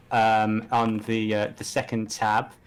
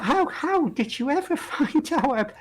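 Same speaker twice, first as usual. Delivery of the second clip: laughing villain; 14 semitones higher than usual. Acted. Villain caught, laughing in character.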